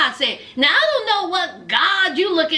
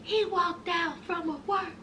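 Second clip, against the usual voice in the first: small, much softer voice; sheepish quiet; 13 dB quieter than usual. Retold or imitated. Shauna excusing her husband's escape, in a sheepish hush. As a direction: murmur it, hoping nobody minds.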